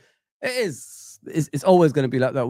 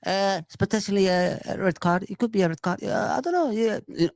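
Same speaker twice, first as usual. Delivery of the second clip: mock hesitant pundit; 3 semitones higher than usual. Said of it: "all you were saying is".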